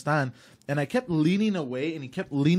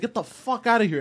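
angry; 9 semitones higher, 3 dB louder than usual. Retold or imitated. Throwing guy out.

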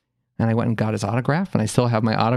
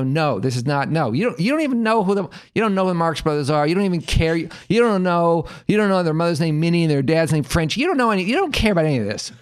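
higher, rapid-fire mock outrage; indignant kid; 6 semitones higher than usual. Boy tells sister she can't come, indignant. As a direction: imitate a boy indignantly shutting out his sister. Retold sibling quarrel, boy objecting.

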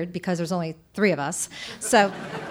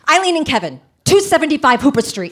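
loud panicked yell of names and address; frantic aunt hollering the kids' address; 5 semitones higher, 7 dB louder, faster than usual. Retold or imitated. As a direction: shout it like a freaked-out aunt. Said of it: "all she can do is yell out our addresses".

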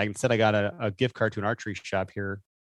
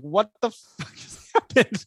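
higher, slow, laughing disbelief; retold disbelief; 12 semitones higher, 5 dB louder, slower than usual. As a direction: replay the shock with a laugh. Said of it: "I was like".

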